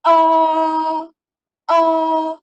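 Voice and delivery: Ah held high, loud and level; first tone demo. Shown as a demonstration. Teacher demonstrating tone one to students, high and level. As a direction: sing Ah out high and flat like Mandarin tone one. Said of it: "Lausha buat".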